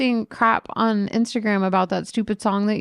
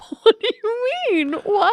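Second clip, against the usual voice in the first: very high squeaky innocent voice; mock clueless friend; 4 dB louder than usual. Teasing her friend about his clueless replies, squeaky high. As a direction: ham up his baffled innocence. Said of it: "he's just like".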